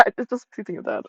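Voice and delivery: laughing at her own morbid joke; laughing repeat. Real, not acted. Repeating the aside through a laugh at herself. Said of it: "repeats line laughing".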